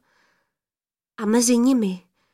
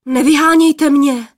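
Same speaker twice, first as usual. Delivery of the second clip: loud, higher startled plea; alarmed young man; 5 semitones higher, 8 dB louder than usual. A narrator's reading. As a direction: plead in alarm not to be driven off. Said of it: "lekl se poplašený mládenec".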